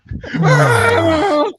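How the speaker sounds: high far yell of a panicking buddy; imitated freak-out scream. Buddy losing it in the gas chamber, mock scream. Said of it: "starts doing, like, the".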